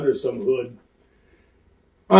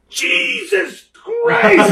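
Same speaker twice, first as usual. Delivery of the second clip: high, very slow, stretched out through a laugh; shocked exclamation; 4 dB louder, much slower than usual. Retold or imitated. Driver sees Andre stand up from the car, slow shocked cry. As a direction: let it out slowly in amazed disbelief.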